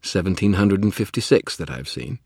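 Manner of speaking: loud exasperated protest. Acted. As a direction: push back, loud and annoyed. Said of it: "I protested".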